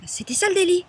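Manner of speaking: loud, high-pitched indignation; indignant outburst. Acted. Woman recounting her arrest to listeners, indignant.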